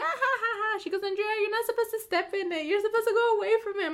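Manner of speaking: high, fast teasing correction; high rushed teasing scold; high mocking lecture. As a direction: tease her like a know-it-all auntie. Family friend voiced laughing scolding.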